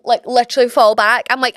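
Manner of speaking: normal talking voice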